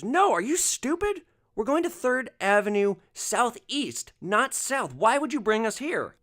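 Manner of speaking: high, loud, contemptuous tirade; angry passenger outburst. Retold or imitated. Passenger yelling at driver over wrong address, furious. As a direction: berate the driver as if he's an idiot. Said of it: "this guy just unloads on me".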